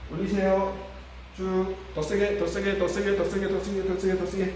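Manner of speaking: loud, high, urgent commands; loud coaching cues. Shown as a demonstration. Therapist pushing the patient through the lift, loud coaching. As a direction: push the patient hard with your voice. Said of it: "다시 한번".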